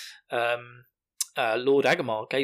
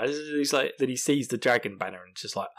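ordinary delivery, nothing marked

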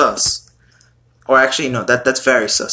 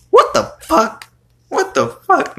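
high, disbelieving what the fuck; real shock at a gross lyric; shocked outcry. Real, not acted. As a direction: yelp of disbelief jumping way up. Shocked by [name] lyric.

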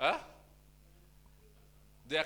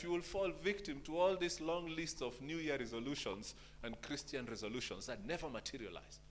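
usual speaking voice

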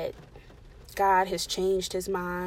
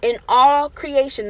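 rising, louder scripture proclamation; preaching; 3 semitones higher, 8 dB louder than usual. Acted. Preacher building the verse to the congregation, louder and louder.

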